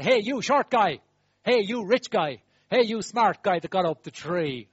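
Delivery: loud, sneering calls at a short man; loud scornful name-calling; mocking shout. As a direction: jeer at him from the roadside.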